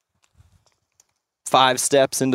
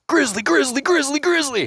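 retold alarm. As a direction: reenact a rapid panicked alert, pitched way up. Reenacts sudden alarm at grizzly.

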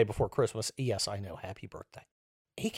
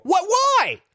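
loud, very high squeal of disbelief; imitating shocked indignation, pitched way up; mock huffy surprise; 7 dB louder than usual. Liars reacting to not being believed, mock huffy surprise. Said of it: "act all huffy and surprised".

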